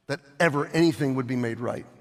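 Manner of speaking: ordinary talk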